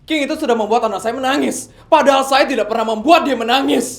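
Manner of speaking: loud, high angry outburst; furious father. Acted. Father to bodyguard about King, furious shout. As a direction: rage at the man who hurt her.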